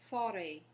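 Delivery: forêt with French r and open final e, no s; forêt said the French way, r in the throat, ending on an open e. Shown as a demonstration. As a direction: model the word forêt for repeating. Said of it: "The first one we have is".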